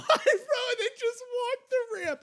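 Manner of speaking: squealing high through laughter; laughing hard. Real, not acted. Laughing retelling of the pyro gag.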